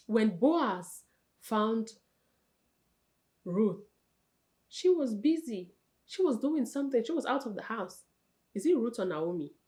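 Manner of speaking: ordinary talk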